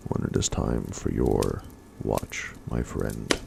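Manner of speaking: ordinary delivery, nothing marked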